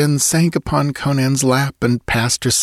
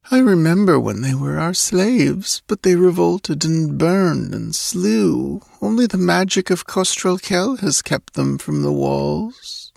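put-on high, dreamy, languid girl's voice; sleepy girl; 9 semitones higher than usual. Girl telling the warrior of the slave revolt, dreamily. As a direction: recall it drowsily, pitched well up.

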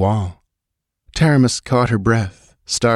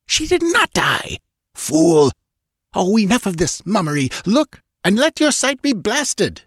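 high-pitched, spitting rage of an evil twin; snarling fury; 12 semitones higher than usual. A narrator's reading. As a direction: lash out high and furious. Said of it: "spat from between the snarling red lips".

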